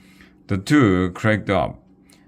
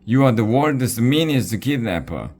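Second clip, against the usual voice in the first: higher, louder furious yell; angry shout; 6 semitones higher, 4 dB louder than usual. A narrator's reading. Angus yelling up at the kidnapper, angry shout. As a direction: shake your fist and yell it upward.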